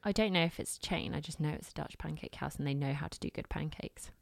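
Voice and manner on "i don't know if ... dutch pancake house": ordinary talk, nothing marked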